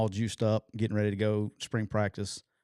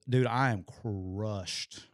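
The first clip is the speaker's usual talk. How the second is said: ordinary talk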